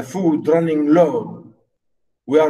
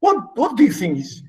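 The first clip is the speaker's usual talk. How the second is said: usual speaking voice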